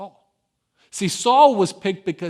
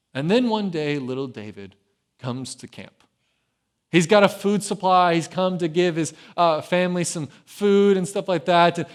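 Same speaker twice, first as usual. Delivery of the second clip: ordinary delivery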